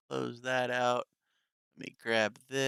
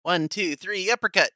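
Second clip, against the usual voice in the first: playful mock fight; 8 semitones higher, 7 dB louder than usual. Playful mock fight after threat.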